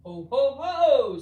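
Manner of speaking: belly-laugh like Santa; jolly Santa laugh. A narrator's reading. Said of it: "said Santa".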